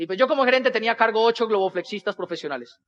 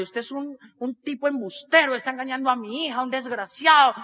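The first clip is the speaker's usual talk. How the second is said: indignant mother; 3 semitones higher than usual. Retold or imitated. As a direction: scold like an offended mother. Angry mother confronts bad face painter. (mamá indignada, imitada)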